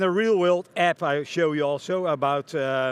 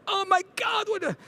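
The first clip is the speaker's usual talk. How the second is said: retold panicked yell. Guide yelling on sighting a rhino, retold as a high mock yell. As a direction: imitate the yelling guide in a high, strained cry. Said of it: "the guide was yelling".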